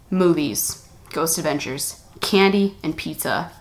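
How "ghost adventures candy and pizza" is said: ordinary talk, nothing marked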